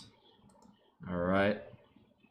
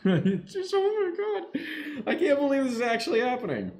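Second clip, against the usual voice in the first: real giddy laughing, much higher; can't believe it, laughing through the words; gleeful, disbelieving fan laughing; laughing disbelief; 3 dB louder than usual. Real, not acted. Disbelief at team winning big.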